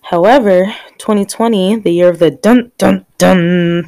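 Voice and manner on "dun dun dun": loud suspense-sting sound effect; mock-dramatic "dun, dun, dun". Shown as a demonstration. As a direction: voice an ominous three-beat sting, drawing out the last. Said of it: "sound effect".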